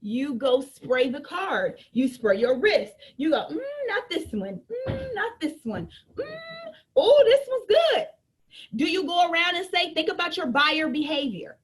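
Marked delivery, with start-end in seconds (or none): 3.5-8.1 high, hammed-up picky shopper; mock customer sniffing perfume. A seller acting a shopper testing perfume, high and hammed up. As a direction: sniff and judge each scent, then light up.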